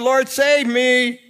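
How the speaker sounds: loud, high, slow cry for help; acted desperate shout. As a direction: cry out drawn-out like a sinking man. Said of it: "He cried out".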